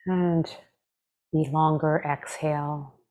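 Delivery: calm, measured meditation voice with long pauses; guided meditation. Acted. Meditation teacher leading listeners through breath and body scan, calm guided meditation. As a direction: guide them gently, leaving space after each phrase.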